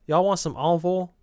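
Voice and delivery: demonstrating a Southern offer; Southern "y'all" and "oil"; Southern accent. Shown as a demonstration. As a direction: put on a Southern accent for the question. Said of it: "the most Southern thing in the world to say".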